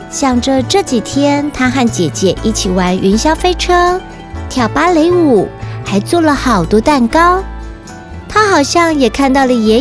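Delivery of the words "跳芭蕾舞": usual speaking voice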